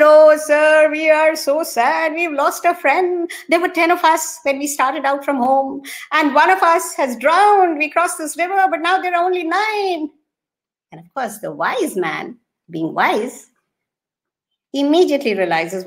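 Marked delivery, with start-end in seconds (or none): 0.0-10.1 high pleading sorrow. Acted. Friends tell the wise man their sad loss, high pleading.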